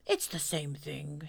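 soft, slow, near-whispered musing; thoughtful. A narrator's reading. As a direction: say it gently, as if pondering. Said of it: "Pooh nodded thoughtfully".

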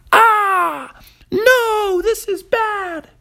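put-on high, loud panicky boy voice; a kid yelping as his sheets catch fire; squeaky alarmed little boy, slowing on the last words; panicked kid. A narrator's reading.

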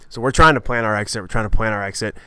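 normal talking voice

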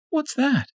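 wife spotting lipstick; suspicious Tanya. A narrator's reading. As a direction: demand to know.

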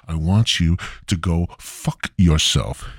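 ordinary talk, nothing marked

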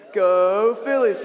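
loud, long drawn-out "Go"; crowd cheer. Shown as a demonstration. Storyteller teaches kids the Philistines' cue, cheering. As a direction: cheer like a fan in the stands. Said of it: "people cheering for them".

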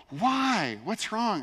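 high-pitched mock incredulity; baffled roommate. Roommate to the man declining to watch with him, baffled. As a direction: protest as if the refusal makes no sense.

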